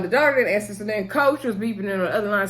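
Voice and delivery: ordinary talk, nothing marked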